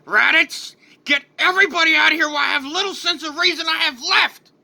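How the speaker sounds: high, fast, urgent yell; screamed order. Acted. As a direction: scream a warning while barely holding on. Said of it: "looks at Raditz and screams".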